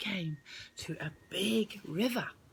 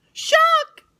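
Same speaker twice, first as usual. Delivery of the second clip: much higher, loud warning cry; alarmed shout; 14 dB louder than usual. Acted. Rose warning Tim of the shark, high loud cry. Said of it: "said Rose".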